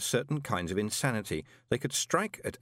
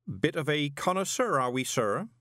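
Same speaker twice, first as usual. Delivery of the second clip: knowing wink; 7 semitones higher than usual. A narrator's reading. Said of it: "he said, winking".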